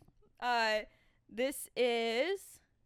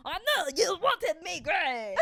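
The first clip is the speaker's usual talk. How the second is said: louder, higher, laughing mock French; mock French accent; 6 semitones higher, 5 dB louder, slower than usual. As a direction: ham it up like a silly French lover. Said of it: "Wah, wah".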